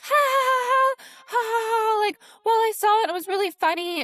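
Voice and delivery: high, loud fake laugh mocking the mom; mocking cackle. Swim instructor laughing off her own joke, high mock cackle. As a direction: cackle it out like a smug older lady. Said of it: "She's like".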